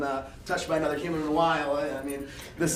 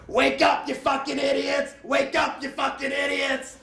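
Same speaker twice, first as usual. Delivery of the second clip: high yelled insult; hammed-up angry wake-up call; mock shouted wake-up; 8 semitones higher than usual. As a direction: bellow to wake the sleepers. Hack waking them at dawn.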